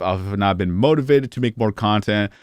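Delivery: usual speaking voice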